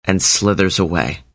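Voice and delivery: ordinary delivery, nothing marked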